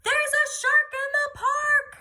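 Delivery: put-on high, loud boy's yell; yells with all his might. A narrator's reading. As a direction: shout the alarm at the top of his lungs. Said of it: "Timothy yells with all his might".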